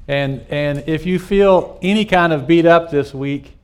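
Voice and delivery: normal talking voice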